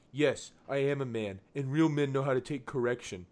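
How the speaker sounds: mock a pompous online commenter; dorky know-it-all talking down; condescending, dorky voice. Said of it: "most condescending, dorky voice I can".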